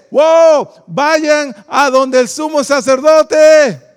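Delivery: big, slow, projected order; loud command. Retold or imitated. Jesus answering the shouting lepers, loud imitated command. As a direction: send them off in a loud, drawn-out call.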